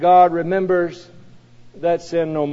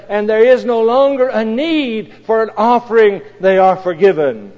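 preaching; 5 semitones higher, 6 dB louder than usual. Acted. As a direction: proclaim it to the congregation. Preacher proclaims forgiveness.

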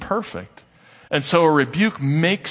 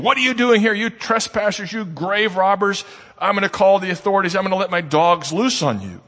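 retold angry shout; 7 semitones higher than usual. Preacher retelling a caretaker shouting at grave visitors, mock angry yell. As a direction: act out the furious man chasing off trespassers. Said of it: "the man who came shouting at us was saying".

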